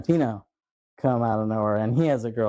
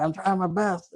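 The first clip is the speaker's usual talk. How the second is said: higher-pitched mock protest; retold pleading; 7 semitones higher than usual. Clerk answering the gunman in a retold scene, mock pleading. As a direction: imitate a flustered clerk, pitched up.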